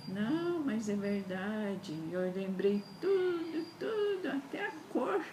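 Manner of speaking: pleading through tears; crying as she insists it is true; tearful insistence. Retold or imitated. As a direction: voice cracking into tears, high. Retelling her own insistent plea.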